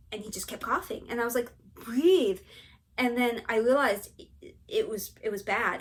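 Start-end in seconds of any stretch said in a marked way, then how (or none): 1.7-2.4 loud, insistent retold order; urgent command. Wife reenacting her plea as husband keeps coughing, loud and urgent. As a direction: push the word out loudly.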